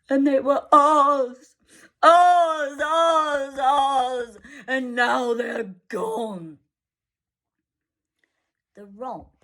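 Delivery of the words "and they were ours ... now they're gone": grieving. Acted. Grieving father.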